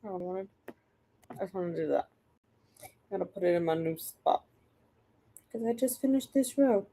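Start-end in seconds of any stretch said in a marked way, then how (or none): none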